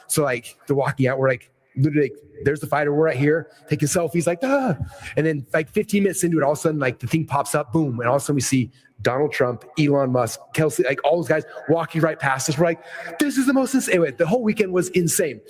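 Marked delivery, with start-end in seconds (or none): 4.4-4.8 high excited yelp; excited gasp. Retold or imitated. Fans seeing fighter up close. 13.2-14.0 high, amazed exclamation. Retold or imitated. Seeing celebrities walk past.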